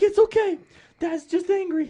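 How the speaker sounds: high-pitched mock mother reassuring the kids; placating mom. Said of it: "And, you know, mom's like".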